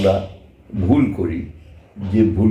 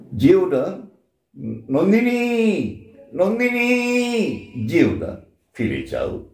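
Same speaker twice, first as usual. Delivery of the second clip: calling Nandini; 8 semitones higher than usual. Retold or imitated. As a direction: call after her, then fade. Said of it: "রক্তকরবের পাঠ নেবার সময়". (নন্দিনীকে ডাকা, উঁচু থেকে নিচে নেমে যাওয়া)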